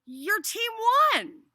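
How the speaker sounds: high, loud shout of victory; acted jubilant call-out; excited yell. A narrator's reading. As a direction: holler the good news across the track. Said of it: "heard someone yell out".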